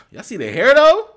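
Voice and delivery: loud, high gloating to the chat; happy and loud. Real, not acted. Streamer proudly showing off his hair to doubters, excited loud. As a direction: shout it, thrilled your hair finally hangs. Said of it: "I'm sorry for being so loud. I'm just happy".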